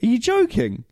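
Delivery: jumps way up in pitch, can't believe it; outraged disbelief at the call; incredulous outburst. Real, not acted. Outraged at the referee decision.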